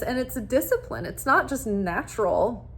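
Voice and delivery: usual speaking voice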